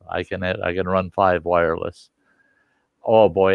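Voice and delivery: ordinary talk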